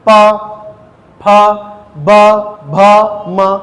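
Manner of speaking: प फ ब भ म each said with the lips pressed shut first; each letter stressed, a pause after each; demonstrating lip sounds. Shown as a demonstration. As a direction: sound out प, फ, ब, भ, म slowly for the class. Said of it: "आप बोल के देखिए".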